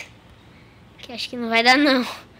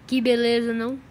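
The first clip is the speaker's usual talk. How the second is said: ordinary delivery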